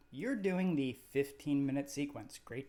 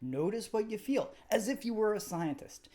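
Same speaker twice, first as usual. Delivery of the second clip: calm, even meditation guide voice; guided meditation. Acted. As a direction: gently lead the listener through the body scan. Leads a guided body scan.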